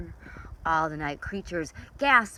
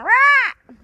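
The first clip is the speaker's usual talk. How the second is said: squawking What; 13 dB louder than usual. Acted. Parrot squawk.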